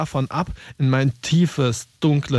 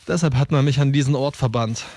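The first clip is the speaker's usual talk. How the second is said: usual speaking voice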